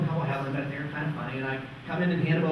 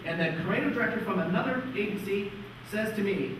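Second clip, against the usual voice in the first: ordinary talk, nothing marked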